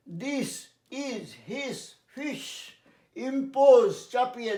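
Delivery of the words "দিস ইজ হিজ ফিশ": slow, soft drill of the short i words; slow drill sentence. Shown as a demonstration. Teacher reading a practice sentence slowly to students. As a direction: read it slowly as a model. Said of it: "drilling short i sentence".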